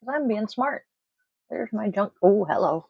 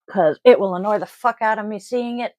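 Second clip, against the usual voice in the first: ordinary talk, nothing marked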